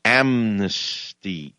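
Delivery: very slow, drawn-out AMNESTY; syllables spread out. Shown as a demonstration. Host mimicking a politician's slow talk for listeners, syllables stretched. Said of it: "spread out syllables and talk slower".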